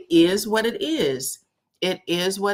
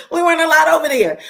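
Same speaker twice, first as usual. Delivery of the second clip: higher, louder and fast; emphatic loud repeat; 8 semitones higher, 9 dB louder, much faster than usual. Real, not acted. Repeating mother's rule to viewers, loud and emphatic. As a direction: hammer the point home.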